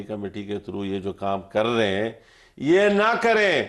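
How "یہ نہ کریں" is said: high, drawn-out retold plea; imitated cry of protest. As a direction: re-enact a long, raised-pitch cry of objection. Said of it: "یہ ہم چیختے رہے، گلا پاڑتے رہے".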